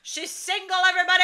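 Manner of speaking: loud, high town-crier holler; mock announcement. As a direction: holler it out to the whole audience. Mock public announcement to audience.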